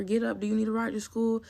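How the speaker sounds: high, kind offer of help; caring mom voice. Retold or imitated. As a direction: sound like a helpful loving mother.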